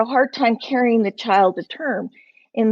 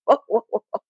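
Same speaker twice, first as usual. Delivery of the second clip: calm friend. Retold or imitated. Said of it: "And she went".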